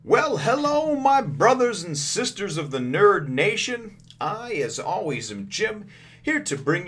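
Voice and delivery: high, loud and slow host welcome; big show greeting. Real, not acted. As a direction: open the show like a hyped host. Show opening greeting to viewers.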